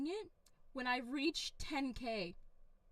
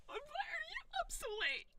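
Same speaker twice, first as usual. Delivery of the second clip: much higher, squealing whine of the question; squeaky echo; 12 semitones higher than usual. Pretend. Streamer throws a viewer's question back, squeaky.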